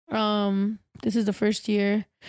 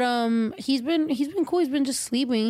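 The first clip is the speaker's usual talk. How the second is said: usual speaking voice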